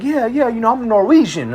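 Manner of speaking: high-pitched mimicked claim; imitating distancing coworker. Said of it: "so he's trying to distance".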